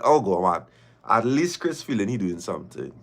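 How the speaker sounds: normal talking voice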